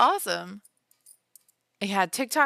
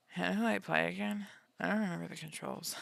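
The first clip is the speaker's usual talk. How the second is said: small, quiet puzzled voice; lost and unsure; 8 dB quieter than usual. Acted. Player booting the game back up, quietly bewildered. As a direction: murmur it softly to yourself, at a loss. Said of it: "I'm like".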